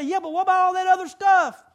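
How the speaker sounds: high, loud protesting question; loud objection. Retold or imitated. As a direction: butt in as a protesting churchgoer. Voices the congregation's loud objection.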